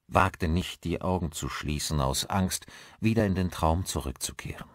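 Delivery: ordinary delivery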